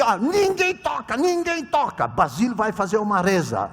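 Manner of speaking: high, loud order to the table; lightly voiced boss calling out; high commanding call. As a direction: stop everyone from touching the food. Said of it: "E ela disse assim".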